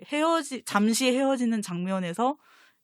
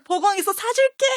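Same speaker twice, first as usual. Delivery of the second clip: higher, excited mock call; imitating an eager offer; 11 semitones higher, 4 dB louder than usual. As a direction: mimic a character eagerly offering a treat. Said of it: "막 이러는 장면인데".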